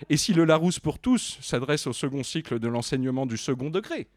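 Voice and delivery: loud, stately reading of a preface; pompous read-aloud of a dictionary intro; formal reading aloud. Acted. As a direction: declaim the preface like an official text.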